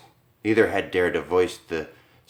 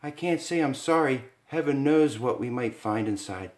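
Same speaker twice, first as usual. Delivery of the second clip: put-on higher, uneasy young wife; nervous Lisa; 6 semitones higher, 3 dB quieter than usual. Wife to husband at locked doors, higher uneasy voice. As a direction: let the worry creep in.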